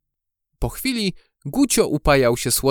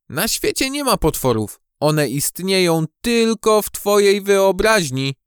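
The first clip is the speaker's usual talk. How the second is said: higher, emphatic character voice; firm dismissal; 7 semitones higher than usual. A narrator's reading. Maja tells Gucio monsters are imaginary, firm. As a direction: insist on it, stretching the key words.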